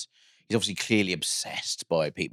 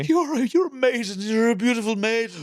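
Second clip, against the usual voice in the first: much higher, louder, swooning camp falsetto; camp stalker; 14 semitones higher, 4 dB louder than usual. Retold or imitated. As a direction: gush like a camp admirer. Mimicking the stalker's gushing praise.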